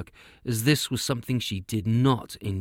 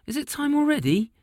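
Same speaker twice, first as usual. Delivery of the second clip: put-on surprised girl. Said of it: "she asked".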